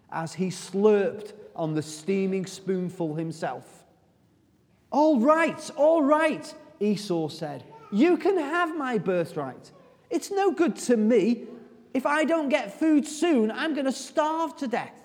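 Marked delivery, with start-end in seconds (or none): 4.9-15.0 high, pushy voice of a starving man; hungry Esau. Acted. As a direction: beg impatiently, pitch up. Starving Esau begs for stew.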